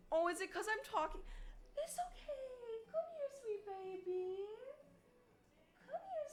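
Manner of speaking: very high, soft cooing pet voice. Real, not acted. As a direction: coo at the animals like babies. Baby talk to pets.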